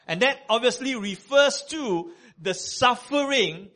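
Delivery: loud, high preaching at full force; full-voiced sermon delivery. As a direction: preach it out to the congregation.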